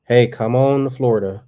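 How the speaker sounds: normal talking voice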